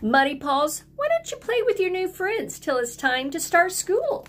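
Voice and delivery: lightly voiced boy, kind suggestion; a hint of a boy's voice. A narrator's reading. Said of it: "said Ben".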